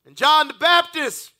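loud, rising sermon climax; preaching at full force. Acted. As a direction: whoop the story like a play-by-play.

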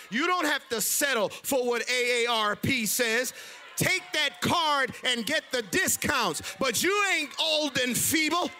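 ordinary delivery

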